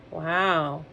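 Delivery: ordinary delivery, nothing marked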